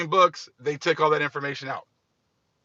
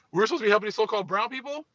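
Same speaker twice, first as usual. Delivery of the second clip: mock disbelief; 6 semitones higher, much faster than usual. Repeated incredulous question.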